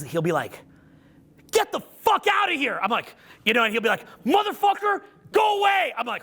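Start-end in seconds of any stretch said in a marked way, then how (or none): 1.5-2.8 high-pitched, drawn-out mock shout; imitated angry yell. Teenage son tells his dad to leave, imitated angry yell. 4.2-5.9 put-on high, strained teen outburst; imitated angry shout. Teenage son snaps at dad who helps him, imitated shout.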